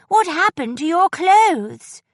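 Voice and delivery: high, loud, slow blurt of alarm; bursting out. A narrator's reading. Edwina alarmed at Miss Glade's clothes, loud burst. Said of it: "Edwina burst out".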